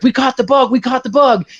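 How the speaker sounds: high, excited soldier's cry; celebrating. Retold or imitated. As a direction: imitate troops cheering the news. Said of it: "everybody's cheering and celebrating".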